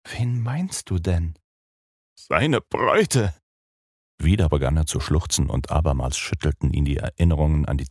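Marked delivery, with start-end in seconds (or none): 0.1-1.4 ruhig. Acted. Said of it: "fragte noch einmal ruhig". 2.2-3.3 verzweifelt. Acted. Jonathan stößt es verzweifelt hervor.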